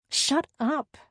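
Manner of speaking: high, short snap; snapped. A narrator's reading. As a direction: snap at your teasing friend. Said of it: "I snapped".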